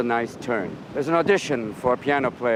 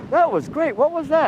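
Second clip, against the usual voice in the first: much higher, excited "That was great"; delighted surprise; 5 dB louder than usual. Acted. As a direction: gush with sudden delight. Owner reacting to jazzy tune.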